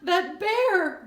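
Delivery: high, loud sobbing words; tearful outburst. A narrator's reading. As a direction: choke it out through sobs. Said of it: "Jonathan was sobbing now".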